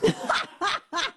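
high-pitched laugh; high, amused burst of laughter. Real, not acted. Reacts to commentator's quip.

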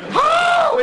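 very high, loud oh; excited cry. Retold or imitated. Hunter recognizing the old Boa Coon, high loud oh.

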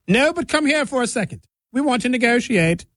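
high-pitched fake British royal; mock British royalty. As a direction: summon them in a high, put-on British royal accent. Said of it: "making the Incas and the Mayas sound like British royalty".

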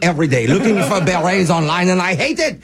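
cartoonish French accent, mock anguish; put-on French accent, lamenting theatrically. Prank caller complaining of the French flu, in a French accent. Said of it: "go in and out of a French accent".

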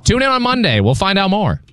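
high-pitched mock radio tease; Colin. Co-host showing how Colin sounds, high mock tease. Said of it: "That's how he sounds".

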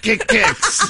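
squeaky, playful kick, kicks; high playful kick-kicks. Retold or imitated. Joking that a human needs ten kicks, high and playful. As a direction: chirp it like a joke.

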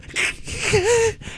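high, soft, tearful giggle; squeaky helpless laughter; giddy laugh. Retold or imitated. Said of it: "why you sound so giddy".

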